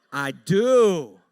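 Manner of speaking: loud, far-off comic punchline voice; mock confession. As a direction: belt the joke admission. Comic self-admission.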